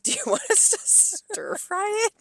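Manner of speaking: very high, squeaky mock bewilderment; incredulous question. Server answering a warm Caesar order, high incredulous squeak. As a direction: squeak it out as an absurd offer.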